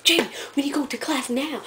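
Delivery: high-pitched scolding imitation; mock-mad friend. Friend urging a staller to class, mock-mad. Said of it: "she gets so mad at me".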